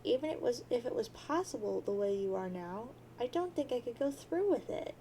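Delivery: normal talking voice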